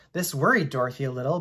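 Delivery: usual speaking voice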